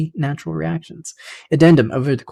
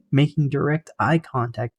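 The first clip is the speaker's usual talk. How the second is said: ordinary delivery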